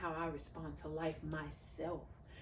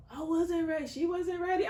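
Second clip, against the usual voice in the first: louder, higher declaration; a loud, raised admission of being unready; emphatic exclaim; 12 semitones higher, 11 dB louder than usual. Real, not acted. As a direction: proclaim it with a raised voice. Exclaiming about motherhood.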